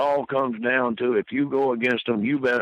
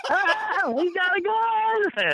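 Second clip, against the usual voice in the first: high shrieking panicked woman; mock hysterical woman screaming; hollering woman; much slower than usual. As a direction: shriek it like a terrified lady. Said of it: "and she hollering".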